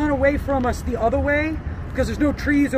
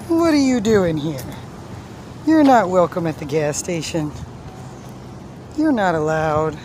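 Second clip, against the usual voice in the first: mock scolding; 7 semitones lower than usual. Scolding the iguana playfully.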